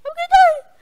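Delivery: high panicked shriek; panicked scream. Real, not acted. Enemy attacking.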